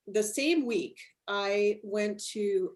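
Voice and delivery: ordinary talk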